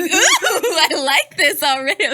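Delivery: high, loud, laughing relish; re-enacted delight, slow and giggly; gleeful anticipation. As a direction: draw it out, tickled by coming gossip. Delighted reaction to promised gossip.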